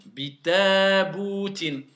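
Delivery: "Bitaboutin" very slow and loud, all vowels kept; slow model reading. Shown as a demonstration. Teacher modelling the full word slowly for pupils. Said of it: "ne veut pas dire faire sauter les voyelles".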